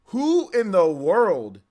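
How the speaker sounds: outraged hype over the music; incredulous rant. Real, not acted. Ranting at haters over music.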